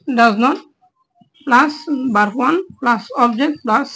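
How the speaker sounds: very slow, drawn-out formula; slow dictation. Shown as a demonstration. Teacher dictating a grammar formula to students, very slow. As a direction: read the formula out slowly for copying. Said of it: "teacher dictates grammar formula".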